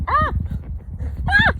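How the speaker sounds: short, sharp "ah" of alarm; real startled little cry; startled yelp. Real, not acted. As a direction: yelp as a bug flies at you. Startled by a June bug.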